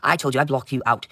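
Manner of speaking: normal talking voice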